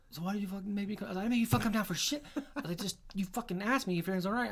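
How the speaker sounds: snapping back at a buddy in disbelief; heated argument. Retold or imitated.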